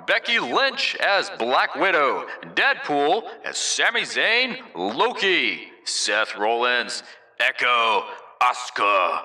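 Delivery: drawn-out ring announcer, pitch swooping high; flavored announcer read. Shown as a demonstration. Host reading the Avengers roster, full announcer voice. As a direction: hype each name like a wrestler's entrance. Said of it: "add a little bit of flavor".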